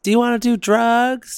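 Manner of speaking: high-pitched mock pitch to a kid; mock sweet offer.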